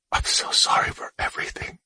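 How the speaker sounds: hushed, tearful whisper. Acted. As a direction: whisper an apology at the bedside. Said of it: "whispered Daxman".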